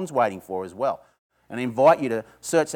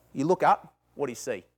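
ordinary talk

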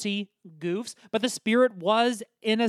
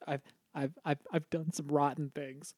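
much softer, faltering stammer; broken confession; 6 semitones lower, 11 dB quieter than usual. Acted. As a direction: barely get the words out. Tearful confession.

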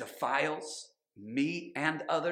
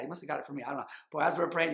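normal talking voice

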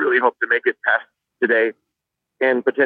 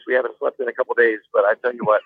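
normal talking voice

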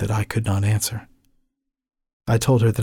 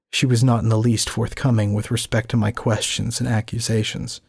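ordinary talk, nothing marked

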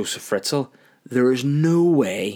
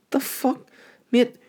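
high-pitched put-on garage man; incredulous mechanic; 14 semitones higher, slower than usual. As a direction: squeak out disbelief as the mechanic. Garage worker's baffled reaction.

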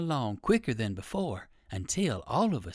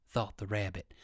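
ordinary delivery, nothing marked

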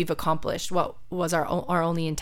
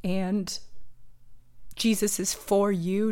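tearful; 2 semitones higher, slower than usual. Real, not acted. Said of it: "I don't know why I'm crying".

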